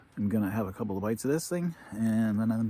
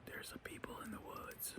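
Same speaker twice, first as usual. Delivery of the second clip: hushed creature whisper; 17 dB quieter than usual. Pretend. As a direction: whisper it like wary animals. Creatures imagined noticing him.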